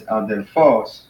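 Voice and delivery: adelPHOS, final syllable punched; last-syllable stress. Shown as a demonstration. Teacher showing the accent is on the last syllable, "adelphos" stressed at the end. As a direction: save the weight for the PHOS of "adelphos". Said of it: "here it's at the end".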